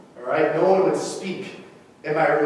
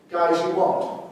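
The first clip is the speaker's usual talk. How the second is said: ordinary talk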